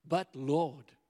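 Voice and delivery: alarmed protest. Acted. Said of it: "exclaimed Ananias".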